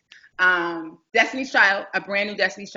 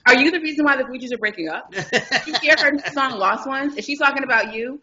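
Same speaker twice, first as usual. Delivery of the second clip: fast, higher barrage of questions; rapid-fire questions; 5 semitones higher than usual. Retold or imitated. Young reporter grills Clef, rapid-fire and eager. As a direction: fire questions without a breath.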